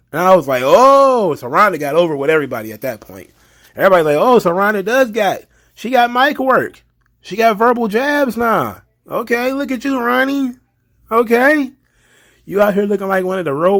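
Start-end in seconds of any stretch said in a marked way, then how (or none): 0.6-1.3 high, loud drawn-out "oh"; hyped-up mock shock; impressed reaction. Retold reaction to a burn. 4.2-8.8 high, loud, hyped-up voice; playfully astonished, pitched way up; impressed crowd reaction. Retold or imitated. Everybody's retold reaction to the burn.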